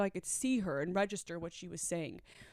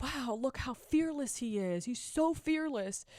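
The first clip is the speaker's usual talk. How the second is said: admiring; 5 semitones higher than usual. Retold or imitated. To herself about the man, in awe. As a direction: gush over how fearless he is. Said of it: "I was like".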